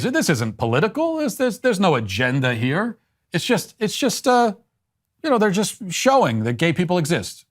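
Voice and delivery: high, sing-song mocking of their excuses; mocking imitation. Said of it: "the other thing they'll say is".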